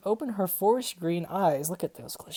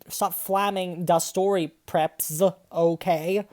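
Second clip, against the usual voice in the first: ordinary talk, nothing marked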